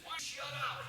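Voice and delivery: high-pitched, exasperated outburst; exasperated retort. Retold or imitated. Paul fed up with the heckler, exasperated retort. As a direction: snap at a heckler in a retold row.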